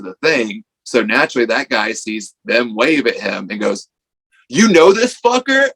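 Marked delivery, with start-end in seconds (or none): 4.5-5.7 mock menacing, much higher and louder; hostile challenge. Stranger demands whether they know the kid, hostile. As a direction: square up to them. Stranger confronts them, retold.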